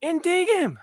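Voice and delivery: very high put-on voice; high mock coach voice; impression of a dating coach. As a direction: squeak it out like a caricature coach. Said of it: "what other impressions should I make".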